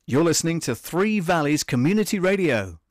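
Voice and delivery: loud, upbeat station ident; radio announcer. Acted. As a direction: punch it like a radio jingle.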